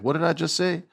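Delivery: high mock Asuka scolding; Asuka voiced high. Retold feud, Asuka snapping at Kairi in high mock voice. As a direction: pitch it way up, bossy and sharp. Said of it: "and Asuka's like".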